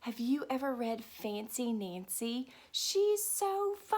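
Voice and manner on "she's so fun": high, slow delighted gush. Real, not acted. Teacher gushing about the character to children, high and slow.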